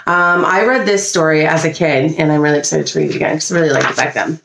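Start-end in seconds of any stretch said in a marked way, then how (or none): none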